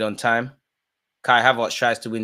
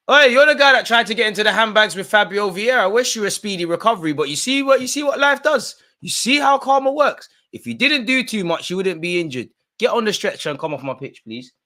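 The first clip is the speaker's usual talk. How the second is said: much higher, louder gloating voice; mocking taunt; 10 semitones higher, 6 dB louder than usual. Real, not acted. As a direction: gloat at the stretchered player. Taunting injured Porto player.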